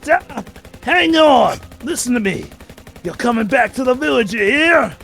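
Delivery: character shout. Acted. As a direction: shout it up the cliff. Voicing Barrett shouting.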